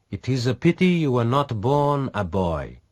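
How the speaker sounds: a hint of a teasing pirate; lightly voiced man, teasing. A narrator's reading. Pirate teases her.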